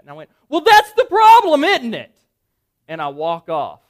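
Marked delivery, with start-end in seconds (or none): 0.5-2.1 loud, high-pitched blow-up; retold angry outburst. Dad losing his cool at the director, furious acted shout. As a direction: explode at her in a high shout. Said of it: "And I was angry".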